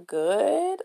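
high, drawn-out uneasy reply; weird voice. Retold or imitated. Teen girl answering a dude in the store, in a weird voice. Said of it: "I did the weird voice".

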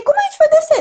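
alarmed question. Retold or imitated. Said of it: "Aí eu perguntei".

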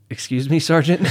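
a startled, disbelieving "excuse me". Retold or imitated. Private caught off guard by the sergeant, startled and laughing. Taken aback by sergeant's question.

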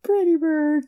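high squawky talking bird; parrot voice. Pretend. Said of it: "Could you say pretty bird?".

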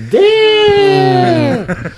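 loud, high hype yell; hype shout. Real, not acted. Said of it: "He was roasting you".

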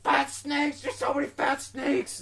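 high, loud alarmed calls while running; panicked yelling. Retold or imitated. Fleeing dream snakes, yelling about them in panic. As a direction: call them out in a frightened screech. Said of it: "I fucking called them as I was running".

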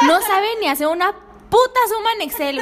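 loud exasperated rant. Real, not acted. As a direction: vent at a useless coworker. Ranting about useless coworker.

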